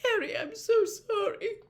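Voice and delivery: high, tear-choked apology; shaky tearful. A narrator's reading. Missus Weasley apologises to Harry, shaky with tears. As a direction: try to steady a crying voice. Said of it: "she said shakily".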